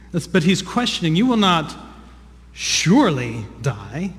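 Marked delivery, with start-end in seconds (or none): 1.1-4.1 surely stretched slowly, rising like a question; stress on surely. Shown as a demonstration. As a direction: make surely sound doubting and drawn out. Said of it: "the emphasis is on that word, surely".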